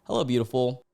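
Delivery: normal talking voice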